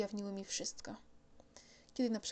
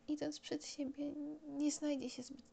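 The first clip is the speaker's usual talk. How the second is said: touch of sadness; 6 semitones higher than usual. Acted. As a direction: let a little sadness creep in, hushed. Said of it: "z odrobiną smutku".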